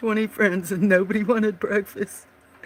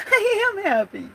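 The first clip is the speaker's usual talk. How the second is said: laughing; 14 semitones higher, 3 dB louder than usual. Real, not acted. Insisting she is happy.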